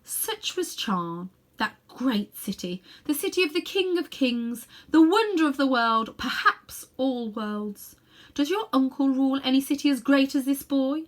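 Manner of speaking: a grand queen, slowing on her city's name; a proud queen voice that rises grand and loud; boastful queen. Acted. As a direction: swell with pride in a lost city.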